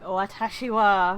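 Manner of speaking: Watashi wa with the last sound said as wa; Japanese particle wa. Shown as a demonstration. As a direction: say Watashi wa, ending on a clear wa. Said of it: "the ha sometimes is pronounced wa".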